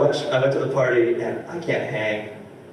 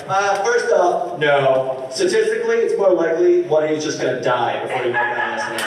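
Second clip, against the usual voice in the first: loud, higher blunt rant; retold outburst; 3 semitones higher, 5 dB louder than usual. Comic snapping at the improv troupe, loud mock rant. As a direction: burst in and crush their dream. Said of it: "I was like".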